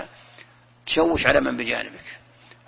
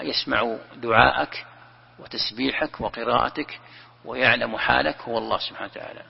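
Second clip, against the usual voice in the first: ordinary delivery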